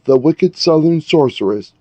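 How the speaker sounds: usual speaking voice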